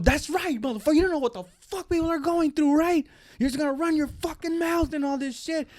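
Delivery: high, indignant voice, volume held back; mock ranting tirade; imitated angry rant. Retold rant of agitated man.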